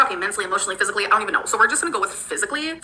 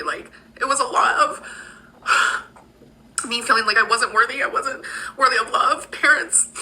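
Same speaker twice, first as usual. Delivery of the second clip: sighing, slowed and pitched up with feeling; emotional; 3 semitones higher than usual. Real, not acted. Admitting she felt unworthy of love, emotional. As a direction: hold back tears through a heavy sigh. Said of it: "This does make me emotional".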